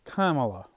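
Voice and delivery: "Kamala" said COMMA-la; "Kamala" as COMMA-la, falling at the end; pronounced like comma. Shown as a demonstration. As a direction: stress the first syllable of "Kamala", like "comma" plus la. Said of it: "the way I say it".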